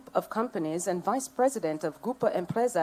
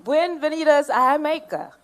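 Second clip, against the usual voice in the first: "Bienvenidos a Jamaica" higher and louder in a proud Spanish try; 8 semitones higher, 7 dB louder than usual. Shown as a demonstration. Speaker welcoming the Spanish hosts in Spanish, loud and proud. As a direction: give the Spanish welcome with gusto. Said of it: "let me try".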